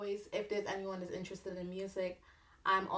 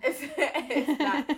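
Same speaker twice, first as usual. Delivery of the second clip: laughing; 6 semitones higher, 8 dB louder, much slower than usual. Real, not acted. Laughing agreement with host.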